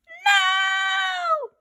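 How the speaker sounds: high shrieking "No!"; terrified scream. Acted. Boy screaming at the ghost bride. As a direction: scream in horror.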